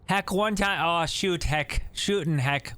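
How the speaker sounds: folksy Canadian storyteller; Canadian accent. Acted. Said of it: "This guy's Canadian".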